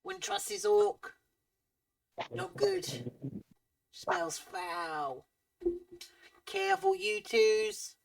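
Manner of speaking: high, squeaky ratfolk whisper-talk; squeaky rat man. Acted. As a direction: play a twitchy little rat man, very high. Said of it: "And he looks at you and goes".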